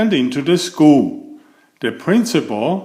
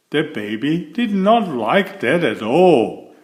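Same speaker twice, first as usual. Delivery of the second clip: usual speaking voice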